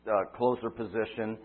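usual speaking voice

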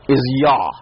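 Yah said as a clear open "yah". Shown as a demonstration. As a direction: give the syllable "Yah" slowly and clearly. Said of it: "the first syllable".

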